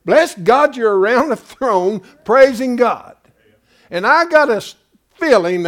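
loud, high fiery preaching; preaching at full force. Real, not acted. Preacher going off at his congregation, loud fiery sermon. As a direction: let it rip like a pulpit thunderer. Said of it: "a little off course on my diatribe there".

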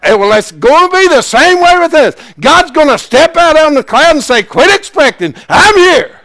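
high, loud revival preaching; preaching at full force. Real, not acted. Preacher firing up the ladies about expecting a baby. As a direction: preach it with everything you've got.

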